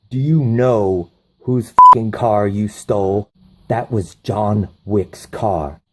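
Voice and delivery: slow, heavy, menacing scolding; stern mob boss. Retold or imitated. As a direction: spell out his mistake slowly. Mob boss scolding his son.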